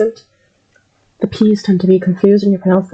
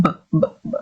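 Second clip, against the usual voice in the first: quieter heavy b sounds from the back; demonstrating b sound; 2 semitones lower, 5 dB quieter, much faster than usual. Shown as a demonstration. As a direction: repeat a soft but heavy b. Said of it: "base of your tongue".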